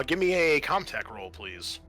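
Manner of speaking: ordinary delivery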